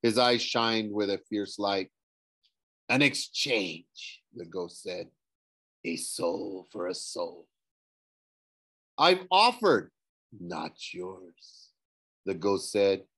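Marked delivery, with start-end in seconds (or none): 2.9-7.5 slow, low, soft ghostly voice. A narrator's reading. Ghost offers a bargain, slow and ghostly. 9.0-9.9 higher, louder insistence; urgent protest. A narrator's reading. Nico insists to the ghost, urgent and louder. 10.3-11.7 slow, low, soft ghostly voice. A narrator's reading. Ghost offers a bargain, slow and ghostly.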